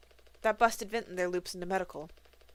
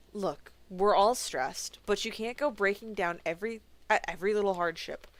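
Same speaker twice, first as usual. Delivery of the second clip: normal talking voice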